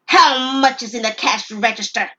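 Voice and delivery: loud, hard demand; robber demanding. Acted. Robber demanding the till money from Leah, loud. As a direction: demand the cash like a threat.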